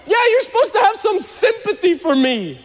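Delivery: very high, whiny protest; mock indignation. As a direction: protest in a high, hammed-up whine. Retold indignant reply to wife.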